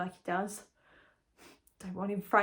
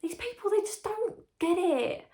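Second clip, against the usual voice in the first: put-on whiny teen; 8 semitones higher than usual. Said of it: "it's just like".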